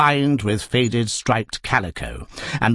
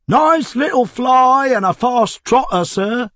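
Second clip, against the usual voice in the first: high, loud, slow hawker's pitch; touting cab driver; 13 semitones higher, 5 dB louder, slower than usual. Acted. Cab driver touting his fly to the Tuggs family, loud and slow. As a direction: sell the cab hard.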